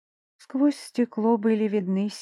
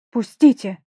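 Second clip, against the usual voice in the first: shouting; 8 dB louder than usual. Acted. Heroine struggling, crying out.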